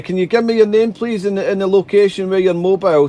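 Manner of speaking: normal talking voice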